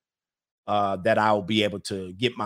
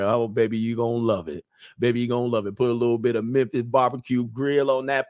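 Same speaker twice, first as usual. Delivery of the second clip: Southern charm. Pretend. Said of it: "turn on that little Southern charm".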